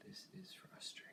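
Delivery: under-the-breath whisper; hushed exasperated aside; frustrated whisper. Real, not acted. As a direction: whisper it to yourself, fed up. Muttered aside while working.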